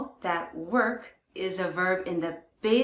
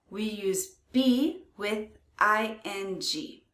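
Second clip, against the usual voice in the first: normal talking voice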